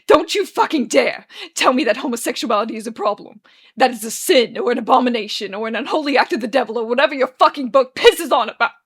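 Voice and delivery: loud furious tirade; blazing anger. Acted. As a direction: unleash all the anger straight at him. All of his anger like a blinding spotlight.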